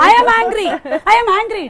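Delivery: play-acted angry shout, said for laughs; mock anger. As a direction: burst out as if furious, hammed up. Host acts out being angry.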